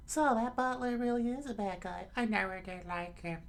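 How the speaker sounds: put-on very high falsetto kid; squeaky little voice; child voice. Children decide the butler is a bad guy, high child voice. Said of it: "the children spoke".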